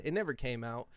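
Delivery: normal talking voice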